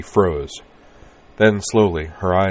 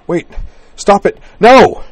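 high, loud panicked cry; cried out; 14 semitones higher, 13 dB louder, slower than usual. A narrator's reading. Woman crying out at her lover to stop. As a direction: scream it out to make him stop.

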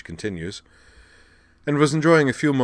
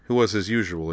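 ordinary delivery

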